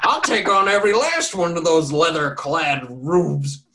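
put-on loud slow old man's rant; hammed-up drunk old farmer rant; defiant rant. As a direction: rail at the guards, laughing. Old farmer ranting about guards.

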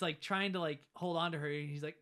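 ordinary talk

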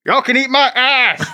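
high, slow defiant jeer from under the sack; defiant prisoner. Acted. Hooded prisoner taunting the crowd, high slow jeer. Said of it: "And he says".

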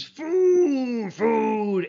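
high, loud monster roar of food; monster scream. Retold or imitated. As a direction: bellow it like a hungry hedge monster. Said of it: "He just screams".

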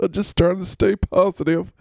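high, chuckling player voice; relentlessly positive, laughing high voice; T Hill. Acted. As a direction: giggle between lines, pitched well up. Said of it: "Why are you laughing so much, T Hill?".

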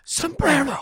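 product name crooned very high; high sing-song jingle. Acted.

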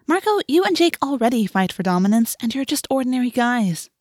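put-on high, cutting girl's voice; pointed rebuttal. A narrator's reading. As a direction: put a boastful friend in his place. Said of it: "Rachel pointed out".

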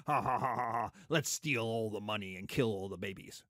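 mock cartoon villain laugh, low; villain cackle. History teacher mocking a cartoon villain, hammy cackle. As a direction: twirl the mustache and cackle. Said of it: "twirling them going".

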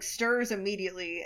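ordinary talk, nothing marked

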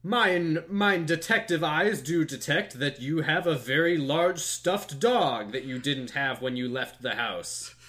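high, loud, slow theatrical detective; overacted Shakespearean king; hammy stage actor. Voicing hammy King Ham.

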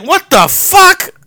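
slow, high-pitched incredulity; baffled disbelief. Retold or imitated. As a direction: stretch it out high, utterly stunned. Acts out his baffled reaction.

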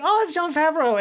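high, sudden realization; surprised realization. Retold or imitated. Fan spotting Jon Favreau's cameo, surprised. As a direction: catch it like a surprise.

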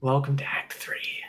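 whispered, eerie echo of the line; a spooky hushed echo; dramatic whisper. Pretend. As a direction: breathe it like a horror trailer.

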